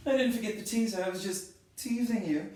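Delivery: loud, high playful tease; playful teasing. Real, not acted. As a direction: tease them, laughing it off.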